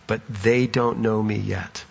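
soft, low and reassuring; gentle Jesus. Acted. Jesus comforting the worn-out Paul, soft and low. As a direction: ease the weary man's doubt gently. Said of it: "And Jesus just says".

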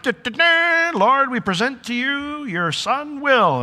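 high, slow fanfare herald voice; trumpet-call a grand arrival in heaven; heraldic announcement. Pretend. Herald presents Will to the Lord, grand announcer voice. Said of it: "the trumpet sound".

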